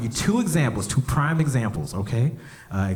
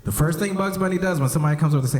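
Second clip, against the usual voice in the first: ordinary talk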